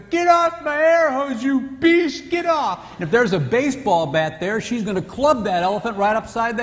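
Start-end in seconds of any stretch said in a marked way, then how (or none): 0.0-2.9 high, loud, slow mock yell; mock furious wife. Wife yelling at the elephant on her air hose, high mock shriek. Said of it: "No, she's gonna".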